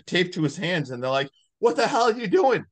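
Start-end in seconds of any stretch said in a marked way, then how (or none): 1.6-2.6 high, loud startled yell; shocked yell. Retold or imitated. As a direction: shout it in disbelief. Retelling characters shocked at Marcus.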